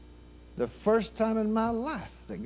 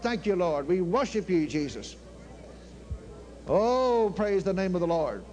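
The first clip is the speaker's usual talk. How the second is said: usual speaking voice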